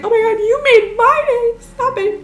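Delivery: very high gushing oh my god; high-pitched happy gush to the fans; flustered squeal. Retold or imitated. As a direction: squeal with flattered delight. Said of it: "And I'm like".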